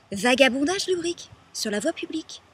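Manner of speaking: loud, high, outraged delivery; indignant repetition. Acted. Woman repeating the charge she was arrested on, indignant.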